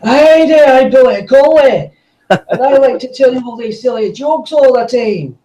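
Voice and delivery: exaggerated Scottish accent, pitched very high; over-the-top high-pitched Scottish accent; Billy Connolly. Shown as a demonstration. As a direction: ham up a high, loud Scottish comic. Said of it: "me being exaggerating the Scottish accent".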